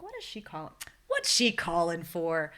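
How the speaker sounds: puzzled wondering. Retold or imitated. Mother seeing her doctor's unexpected call, puzzled. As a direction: wonder aloud, then stretch the question out.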